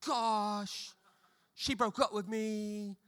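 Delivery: slow, mopey complaint; whiny heartbroken teen.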